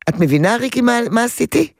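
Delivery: ordinary talk, nothing marked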